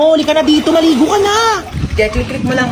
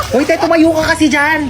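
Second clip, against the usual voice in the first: ordinary delivery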